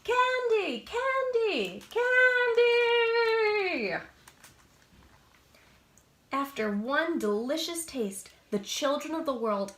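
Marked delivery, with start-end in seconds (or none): 0.0-4.0 high, loud chant that builds to a long shout; kids yelling. Acted. Kids shouting at Lollipop for candy, building yell. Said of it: "all the kids start yelling".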